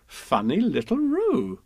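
high, slow indulgent coo; drawn-out singsong mother; fond motherly Kanga. Acted. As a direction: coo at a funny child. Kanga fondly teases.

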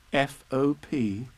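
ordinary delivery

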